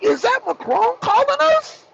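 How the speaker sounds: high, incredulous mock Russian officials; stunned Russians. Kremlin aides staring at the caller ID, high and incredulous. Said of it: "They all must have been like".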